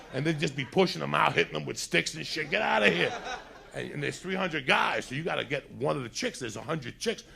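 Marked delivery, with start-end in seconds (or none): 2.5-3.1 mock bark. Bouncers pushing girls out.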